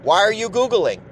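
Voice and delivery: mock girlfriend scolding him; upset girlfriend. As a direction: imitate the girlfriend nagging. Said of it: "She was like".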